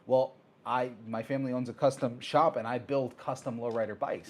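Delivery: ordinary talk, nothing marked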